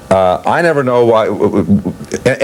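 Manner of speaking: ordinary talk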